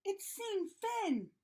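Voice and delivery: high-pitched, alarmed outcry; crying out. A narrator's reading. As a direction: cry out in dread, stretching the first words. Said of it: "Rita cried".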